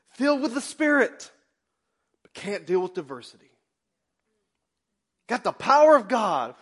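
high, loud preaching cry; fiery preaching. Acted. Preacher crying out to the congregation. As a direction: preach it hard to the church.

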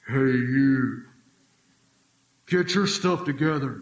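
loud, slow booming voice from the clouds; booming Heston God. Retold or imitated. Preacher mocking a Charlton Heston God voice, booming. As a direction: thunder it like an epic movie God. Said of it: "this Charlton Heston voice".

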